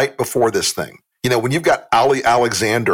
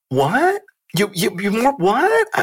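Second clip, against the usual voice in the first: mock baffled; 10 semitones higher, slower than usual. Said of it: "it was like".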